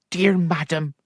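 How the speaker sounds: lightly voiced maid; a servant breaking news; anxious maid. Maid warning her mistress, lightly voiced.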